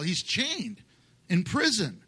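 normal talking voice

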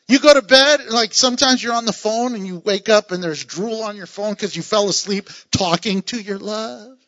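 ordinary delivery

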